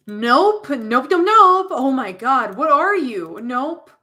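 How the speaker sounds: loud, rising string of nopes; freaked-out refusal. Acted. Sam seeing the moth's wings, freaked out. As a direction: back away in alarmed disbelief.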